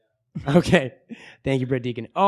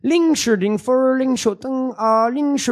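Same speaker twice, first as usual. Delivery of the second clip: fake Chinese gibberish; 7 semitones higher than usual. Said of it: "these are not real words".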